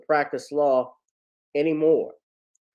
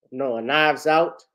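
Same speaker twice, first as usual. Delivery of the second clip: normal talking voice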